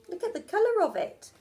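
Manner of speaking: quick, high amazed aside; excited aside. Real, not acted. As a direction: rush it out in wonder.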